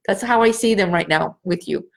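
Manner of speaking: usual speaking voice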